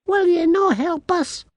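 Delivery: very high, loud little-man plea; pleading tiny Scot. A narrator's reading. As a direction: beg for help in piping Scots.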